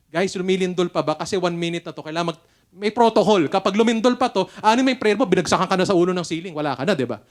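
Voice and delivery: loud, urgent warning to the room; rally the crowd to safety, loudly; urgent call out. Retold or imitated. Pastor calling to attendees mid-quake, loud and urgent. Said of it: "tinanong ko yung mga attendees".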